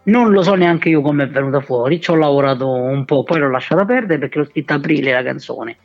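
ordinary talk

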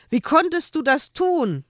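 angry. A narrator's reading. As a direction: scold him, upset. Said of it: "Joel war nun auch wütend".

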